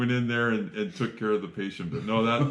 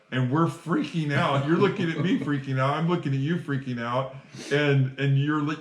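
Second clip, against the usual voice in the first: ordinary delivery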